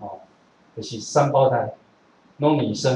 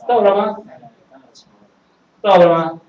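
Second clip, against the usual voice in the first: surprised call; 6 semitones higher, 7 dB louder than usual. Real, not acted. Asking if time is up.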